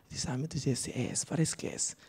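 hushed, breathless whisper to herself. Retold or imitated. Comedian whispering to herself over a handsome guy. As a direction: whisper it like a secret thrill.

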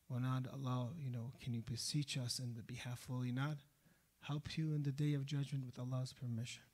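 ordinary talk

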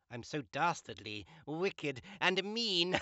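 villainous gloat rising into evil laughter; gloat, climbing in pitch, then cackle loudly; hammy evil villain with long loud laugh; wicked. Shown as a demonstration.

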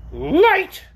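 high, loud creature voice with mild unease; somewhat nervous orc. A narrator's reading. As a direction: squeak it as a slightly uneasy orc. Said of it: "it said, somewhat nervously".

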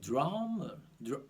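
drummer in an American accent, heavy rolled-back R. Shown as a demonstration. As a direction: lean hard on the R in drummer, American style. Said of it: "ตัว R มันจะเยอะมาก". (คำว่า drummer สำเนียงอเมริกัน ออกเสียง R หนักๆ)

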